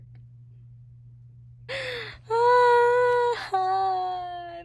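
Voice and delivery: high, loud emotional wail; loud high cry of feeling. Real, not acted. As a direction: wail out, overcome. Overwhelmed by the book.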